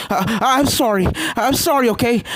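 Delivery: high, loud frantic panting apology; panicked pleading. A narrator's reading. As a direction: beg in breathless panic. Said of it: "Starlight started to hyperventilate".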